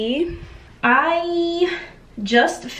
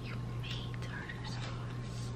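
breathy whispered echo, slow; hushed whisper; 19 dB quieter, slower than usual. Real, not acted. Girlfriend whispering back to boyfriend about his tartar sauce.

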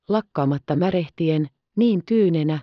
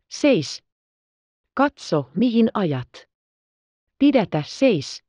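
raised pitch, restrained cry of command; mild shout; 4 semitones higher than usual. A narrator's reading. Ben Hur calls to Messala to stop the horses, mild shout. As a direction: call out urgently, without full volume. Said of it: "huusi Messalalle".

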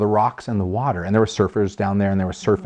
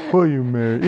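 2 hammed-up proposal; 3 semitones higher than usual. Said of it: "if you had asked me like that".

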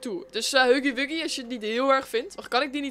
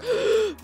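startled gasp; 9 semitones higher than usual. Real, not acted. Sudden scare mid-sentence.

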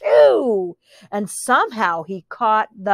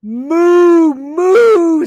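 loud, higher long moo; loud mooing pig; 5 semitones higher, 7 dB louder than usual. Acted. The sick pig moos loudly. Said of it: "said the pig".

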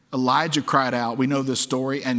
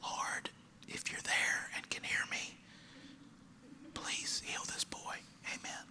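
barely audible whispered prayer; tiny, hushed mock-timid plea; 18 dB quieter than usual. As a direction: whisper it meekly, with hesitant pauses. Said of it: "He didn't go".

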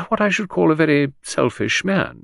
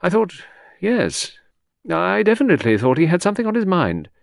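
higher secretary voice, hesitating; wavering confidence; 8 semitones higher, 3 dB louder than usual. A narrator's reading. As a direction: less confident, searching reply. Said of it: "the confidence of Beryl's replies wavered".

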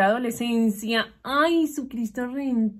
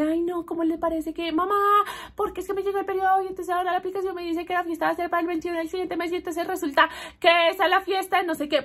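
put-on high, fast rushed teenage complaint; upset teen daughter; 7 semitones higher, faster than usual. Lily complaining to her mother, in a high rushed voice. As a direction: rattle it off like a panicked teen. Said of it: "Ella tiene como algo parecido ahí en el celular y dice".

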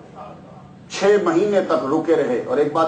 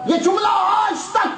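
preaching shout. Acted. Preacher proclaims at full force.

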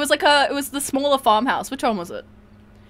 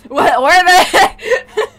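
slow, louder laughing repeat; 5 semitones higher, 7 dB louder, much slower than usual. Real, not acted. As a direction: laugh through the words.

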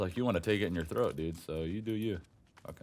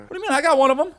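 excited protest; 12 dB louder, faster than usual. Real, not acted. Defending his kill.

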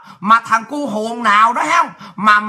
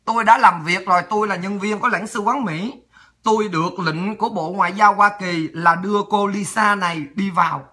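lower, measured official voice; consulate official; 4 semitones lower, 3 dB quieter than usual. Retold or imitated. Consulate woman telling airport guard to admit Lisa, low and official. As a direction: state it low, like an official on orders. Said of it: "Bà Marcel bà mới nói".

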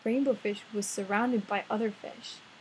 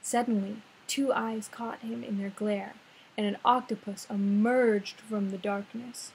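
ordinary delivery, nothing marked

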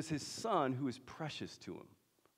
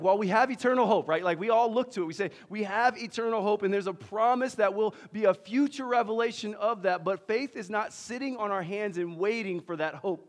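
preaching intensity; 7 semitones higher, 8 dB louder than usual. Real, not acted. Preaching at full force.

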